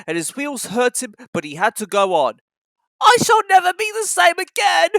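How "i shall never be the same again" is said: high, loud woeful lament; sad. A narrator's reading. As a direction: moan it sadly, high. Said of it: "he thought sadly".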